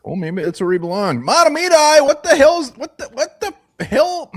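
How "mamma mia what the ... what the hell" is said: shocked exclamation. Real, not acted. Host shocked seeing guest's room.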